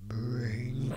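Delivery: deep burped alien voice. Shown as a demonstration. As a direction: sound like an alien. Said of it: "You literally sounded like an alien".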